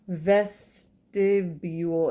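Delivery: "Vestibule" drawn out very slowly; slow, separated syllables on "Vestibule"; sounding out. Real, not acted. Reader sounds out word while searching.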